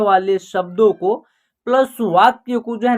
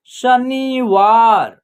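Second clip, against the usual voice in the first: very slow, each syllable apart; slow clear word demo; much slower than usual. Shown as a demonstration. Teacher modelling a new word for children, very slow. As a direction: drag the word out for beginners. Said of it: "और न में छोटी की मात्रा नी".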